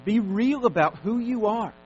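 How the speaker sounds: loud, high, drawn-out exhortation; forceful preaching. Acted. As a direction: hammer each command at the congregation.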